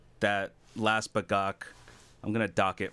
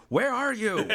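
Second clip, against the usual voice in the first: laughing quote; 12 semitones higher, 4 dB louder than usual. Retold or imitated. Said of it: "Al texting me going".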